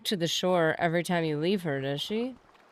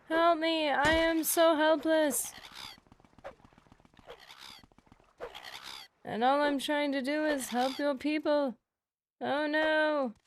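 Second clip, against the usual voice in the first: much higher, slow, hammed-up cry for help; mock helpless victim; 6 semitones higher, slower than usual. Player mocking the lost companion while begging her to come, higher hammed-up plea. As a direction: play the damsel in distress with a wink.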